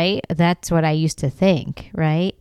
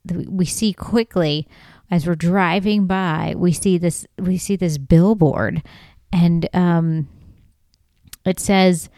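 usual speaking voice

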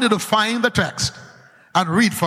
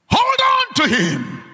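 king's command. Acted. King commanding soldiers to seize the prophet, angry. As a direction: order the guards to seize him. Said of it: "the king said".

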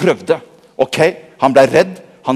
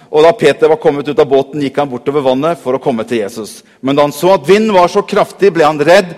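usual speaking voice

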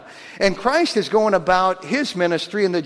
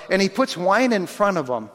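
normal talking voice

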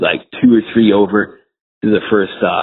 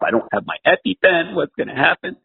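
mock panic; 9 semitones higher than usual. Acting out panicking allergic golfer.

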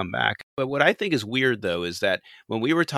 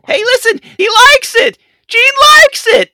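very high, loud squawk; shrill screeching bird woman; 14 dB louder than usual. Pretend. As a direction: shriek it like a frantic bird. Continuing the bird bit.

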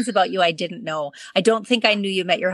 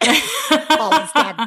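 spooky backwards voice; 8 semitones higher, 3 dB louder, much slower than usual. Pretend. [name] backwards joke.

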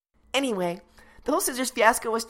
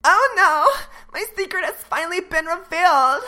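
mock shock; 5 semitones higher, 9 dB louder than usual. Daughter's secret exposed.

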